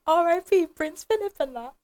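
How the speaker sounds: a cheeky high-pitched send-up of the co-host; mocking imitation. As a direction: parrot him back in a silly high voice. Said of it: "You shouldn't have said it like that".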